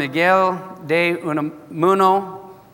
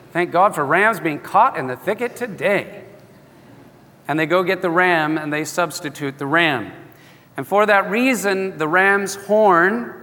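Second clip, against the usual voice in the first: normal talking voice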